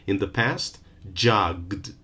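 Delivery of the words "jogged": jogged as one falling syllable ending in gd; no vowel between g and d in jogged; jogged with a voiced d ending. Shown as a demonstration. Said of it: "We don't add an extra vowel".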